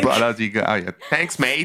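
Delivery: put-on playful Australian accent, laughing; chuckling Aussie bloke accent. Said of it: "ton accent australien est pas mal".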